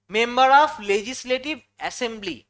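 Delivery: measured, slow dictation of the full form; slow full-form demo. Shown as a demonstration. Teacher spelling out MLA for students, slow and deliberate. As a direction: spell out the full term slowly, word by word. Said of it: "যখন আমি বলবো".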